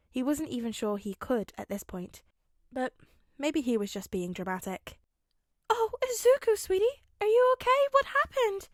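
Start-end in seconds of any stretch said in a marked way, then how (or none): 5.7-8.7 high, loud, fussing mother voice; worried mum. Acted. Mum seeing her bruised son, high and fretful. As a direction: fuss over him like an anxious mum.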